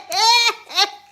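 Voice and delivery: high, very loud laughter. Real, not acted. Amused by chat's Indian accent.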